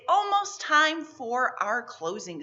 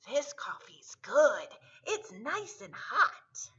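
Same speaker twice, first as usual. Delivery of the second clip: mild whisper; 4 semitones higher, slower than usual. Acted. Groundhog savours coffee.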